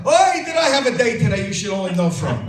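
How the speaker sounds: loud, high Yiddish-style complaint; wife moaning about her day in bed, loud and high; kvetching wife. Acted. Wife's kvetch in place of moan.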